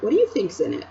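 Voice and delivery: ordinary delivery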